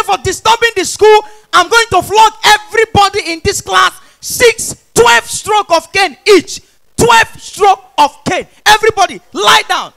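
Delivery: high, loud punishing decree; slow down on the sentence and call it out; stern principal. Acted. Principal announcing caning to a noisy class, stern shouting.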